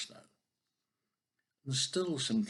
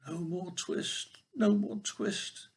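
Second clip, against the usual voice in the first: feverish mumbling; 9 semitones higher, much slower than usual. Acted. Fevered tailor in his bed moans about his twist, slow and high. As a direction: drag it out like a sick man dreaming. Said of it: "in his dreams he mumbled".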